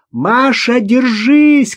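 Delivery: high, desperate shout. A narrator's reading. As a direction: cry out to a child in danger. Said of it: "крикнула Ирина". (отчаянный высокий крик)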